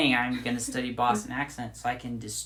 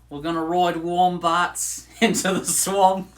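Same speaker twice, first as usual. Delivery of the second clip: slow, louder cartoon Aussie, laughing; Australian accent; 4 semitones higher, 7 dB louder than usual. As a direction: drag out a clichéd Australian accent through a laugh. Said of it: "I'm using every cliche I can think of".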